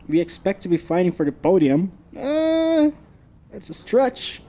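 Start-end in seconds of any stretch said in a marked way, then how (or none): 2.2-4.4 high, skeptical scoff; sarcastic. Real, not acted. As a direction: scoff at the idea. Doubting the podium prediction.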